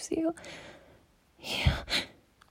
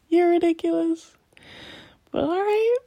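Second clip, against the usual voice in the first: higher-pitched, laughing tease; amused giving in; 7 semitones higher, 7 dB louder than usual. Acted. Lover giving in to a partner's silly argument, amused and higher. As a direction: laugh it off and playfully relent.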